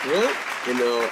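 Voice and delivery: ordinary talk, nothing marked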